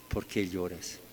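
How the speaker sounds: más grave y mucho más suave, "¿Por qué lloras?" apagándose; repítelo como un eco tierno y bajo; repetición casi susurrada. Retold or imitated. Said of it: "que preguntan a María". (el ángel repite la pregunta a María, muy bajito)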